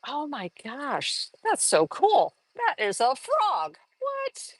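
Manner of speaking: high, slow, wide-eyed gasp of wonder; mock astonished delight, rising to a squeak on what; amazed onlooker. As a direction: act out a viewer who just spotted the hidden frog. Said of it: "people are gonna go".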